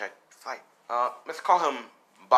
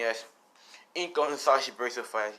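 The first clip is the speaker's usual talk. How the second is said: ordinary delivery, nothing marked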